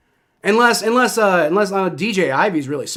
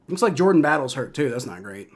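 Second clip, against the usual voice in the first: ordinary talk, nothing marked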